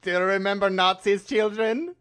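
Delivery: a hint of a menacing German accent; lightly accented evil German; mock German villain. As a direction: ham up a movie German villain. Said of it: "I love the German stereotype of".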